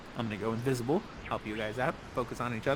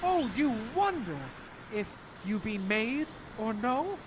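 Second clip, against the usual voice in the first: higher, hushed theatrical wonder; Ferdinand's awed cry; 5 semitones higher, 6 dB quieter than usual. Acted. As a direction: play an awestruck young lover. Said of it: "you cry out at Miranda".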